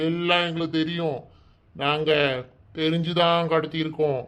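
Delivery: normal talking voice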